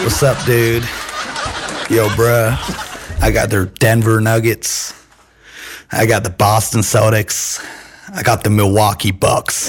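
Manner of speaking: bad Texas-tinged American accent. Shown as a demonstration. Guest names his NBA picks on request, shaky-region American accent. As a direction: attempt a rural Southern Californian American accent. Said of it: "felt like a bad Texas accent".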